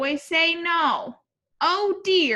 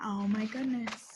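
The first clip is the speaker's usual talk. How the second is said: ordinary delivery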